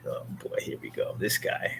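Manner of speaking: voiceless mock-weary mutter; hushed, exasperated father; whispered aside. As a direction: breathe it out like a tired dad. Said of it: "he was like".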